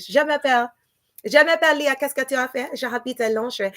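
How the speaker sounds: put-on show-off classroom French in an English accent; schoolgirl French. Host showing off the little French she knows, playful. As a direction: rattle off the few French phrases you know. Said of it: "I start speaking like that".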